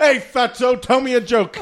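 high, loud heckle through laughter; mocking shout. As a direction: holler it as a joking taunt. Mocking the old friend's opener.

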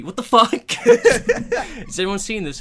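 high, loud, breaking into laughter; excited disbelief. Real, not acted. Podcaster amazed by a crazy film ending, excited disbelief. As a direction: rave about it at the top of your voice.

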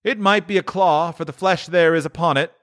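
high, sorrowful spirit reply. Acted. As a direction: answer with sorrow. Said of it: "the spirit's sorrowful reply".

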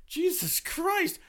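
high, drawn-out squeal of protest; retold exasperation. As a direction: stretch it out high, as if being crushed. Retold reaction to being squeezed.

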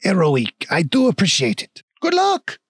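high, gushing mock-French farewell; grateful French dwarf, pitch leaping up; bad French accent. Dwarf shopkeeper bids heroes farewell, bad French accent. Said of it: "very shitty French accent".

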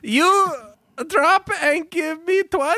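very high, squeaky dim echo; parroting recruit voice. Acted. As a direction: repeat the order back cluelessly.

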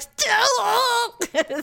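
high mock wail, half laughing; crying. Hurt woman answering her partner, in a mock crying wail. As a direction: wail it high, hammed-up tears. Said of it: "So I start crying".

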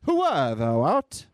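slow, theatrical declaiming; Shakespearean. Pretend. Reader takes co-host's direction, mock-Shakespearean grandeur. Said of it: "Pretend you're reading Shakespeare".

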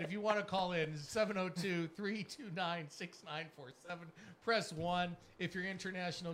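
usual speaking voice

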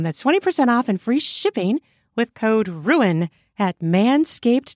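cheeky podcast sponsor spot; upbeat ad read. Acted. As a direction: sell it like a cheeky radio ad.

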